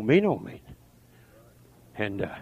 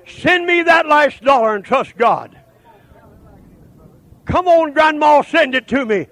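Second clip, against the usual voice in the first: loud, slow, high fiery preaching; shouting the call to fight; preaching at full force; 10 semitones higher, 9 dB louder than usual. Acted. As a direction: thunder it out to the congregation.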